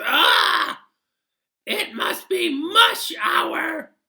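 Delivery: high, loud cartoon passenger, slow and sure; odd passenger voice. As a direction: squeak it out like a goofy know-it-all.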